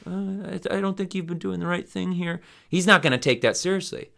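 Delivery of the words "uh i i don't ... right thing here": soft, hesitant and higher; shy apology to a tough guy; quietly timid, pitched up; meek. Retold or imitated. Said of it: "if you just meekly say to the guy".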